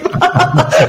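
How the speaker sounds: a burst of high laughter; real laugh. Real, not acted. Laughing about her own typo with the interviewer, a bright burst. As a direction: let the laugh bubble up.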